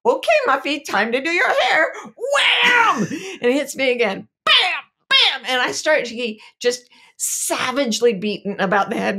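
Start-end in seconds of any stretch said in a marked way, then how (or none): 0.1-1.9 put-on high, loud bossy little girl; toddler voice. Mom retells daughter brushing her hair, high bossy child voice. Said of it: "and she says". 2.0-3.0 sound effect. Retold or imitated. Hairbrush blows in the retelling. 5.1-5.4 sound effect. Retold or imitated. Hairbrush blows in the retelling.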